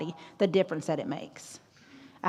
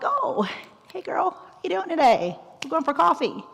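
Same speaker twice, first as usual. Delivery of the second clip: much higher, chatty and bubbly; excited gushing; 10 semitones higher than usual. Pretend. Greeting a happy best friend, excited and chatty. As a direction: gush like best friends off to coffee.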